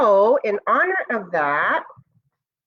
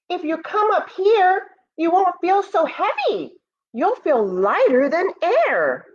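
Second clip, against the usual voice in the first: a higher, coaxing moon voice; lightly voiced moon; 9 semitones higher than usual. The moon coaxing dinosaurs to visit her, lightly higher. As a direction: gently tempt them upward.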